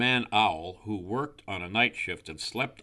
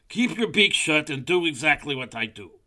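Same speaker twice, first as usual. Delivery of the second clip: high, loud bossy owl; 7 semitones higher, 8 dB louder than usual. A narrator's reading. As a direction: order your friend about, voice raised. Owl orders crow about.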